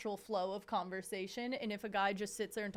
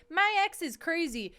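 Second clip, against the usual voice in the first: mocking quote; 8 semitones higher, 6 dB louder than usual. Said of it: "who was like".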